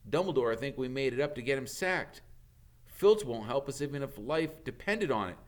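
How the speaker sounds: lightly voiced anxious schoolboy; Harry. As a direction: argue it gloomily.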